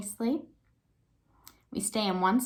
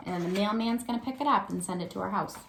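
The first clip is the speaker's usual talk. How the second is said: ordinary talk, nothing marked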